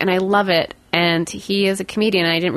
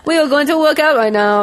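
put-on laughing Austrian accent, high and loud; Arnold Schwarzenegger impression; 7 semitones higher, 4 dB louder than usual. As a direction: bark out the workout in an Austrian accent. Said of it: "Two for two on the Arnie impersonation".

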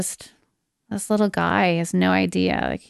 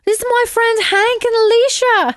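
put-on high British child voice; British little boy; 4 dB louder than usual. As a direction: proudly introduce friends as a British kid. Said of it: "this little boy's name".

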